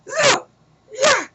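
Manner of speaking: pissed-off, excited screech, very high; hammed-up high-pitched raving threat; Bennett. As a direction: rant it in a wild, sky-high mock scream. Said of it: "he's pissed, kinda like Bennett".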